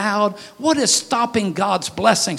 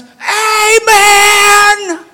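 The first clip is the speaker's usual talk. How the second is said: sudden loud high cry of amen; a cheering shout of amen; 12 semitones higher, 7 dB louder than usual. Retold or imitated. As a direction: blurt it out at the ballgame. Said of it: "They didn't want you to say at a ball game".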